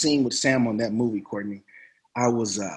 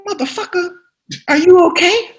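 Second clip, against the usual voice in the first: high-pitched, incredulous star actor; Sam Jackson impression; 5 dB louder than usual. Veteran actor checking on a shaky newcomer, incredulous impression. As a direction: challenge the nervous rookie, much higher than usual. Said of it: "so he looks at me, he goes".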